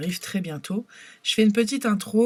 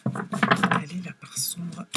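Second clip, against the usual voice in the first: whisper; 3 semitones lower, 5 dB quieter, slower than usual. Acted. Dramatic reveal of the dark side.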